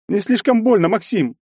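high, loud anguished cry; sudden cry. A narrator's reading. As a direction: burst out in pain. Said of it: "закричала вдруг Ольга".

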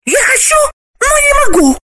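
very high, loud woman's wail; high, loud female wail; desperate cry. Acted. Galina's high voice continues.

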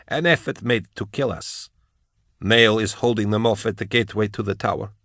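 lightly voiced, softened report; possessed woman's voice. Ravener briefs his mentor through Kara's lips, quietly voiced. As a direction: report it quietly in another's borrowed voice.

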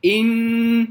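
إن slowly with a long held nasal hum; stopping on shadda. Shown as a demonstration. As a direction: stop on إن and hold the doubled noon. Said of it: "الفارق بين إن والذين". (الوقف على إن بغنة طويلة)

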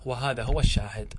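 ordinary talk

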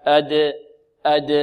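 أد with a neutral echo on the d; bounced dal. Shown as a demonstration. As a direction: release the d of أد without a vowel. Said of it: "هكذا".

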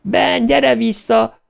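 very high, drawn-out cry; slow, high-pitched desperate wail without much volume; desperate scream. A narrator's reading. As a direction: call him back in despair. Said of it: "sikoltotta kétségbe esetten".

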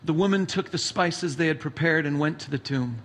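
measured, solemn Bible reading; slightly low, steady reading voice; scripture reading. Acted. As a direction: read the Gospel to the congregation. Reads scripture passage aloud.

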